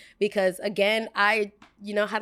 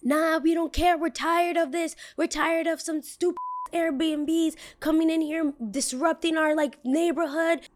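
high whiny voice mocking the neighbor; 8 semitones higher than usual. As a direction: raise the pitch and whine like the annoyed neighbor. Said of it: "And the guy is like".

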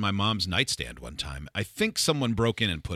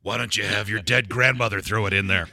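ordinary talk, nothing marked

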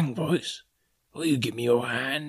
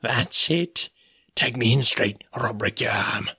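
sneering. A narrator's reading. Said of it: "he sneered".

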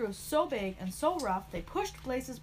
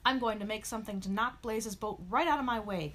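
plain reading voice

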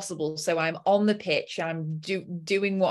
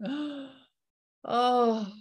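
surprised gasp; 6 semitones higher than usual. Real, not acted. Gasp at money arriving.

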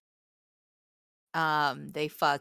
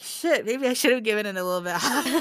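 fast, higher, louder, breaking into a laugh; laughing self-quote; 6 semitones higher, 5 dB louder, faster than usual. Retold or imitated. Rethinking the book's rating to her co-host, laughing fast. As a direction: tumble it out fast and giggle at the end. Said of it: "I'm like".

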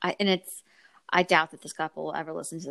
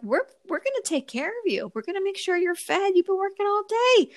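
much higher, sweet reassuring planner voice; caring planner; 11 semitones higher than usual. Retold or imitated. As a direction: mimic a doting planner promising food. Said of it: "planners are like".

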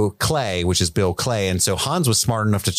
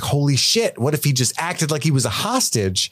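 higher-pitched eureka moment; mock amazed, pitched up; excited realization; 7 semitones higher than usual. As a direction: imitate a writer struck by an idea. Acting out writer's sudden idea.

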